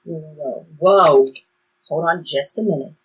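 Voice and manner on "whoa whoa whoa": rising to a loud halt; whoa there. Acted. Traveler halting eager Bree before she rushes off, loud and firm. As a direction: stop her sharply, the last whoa much louder.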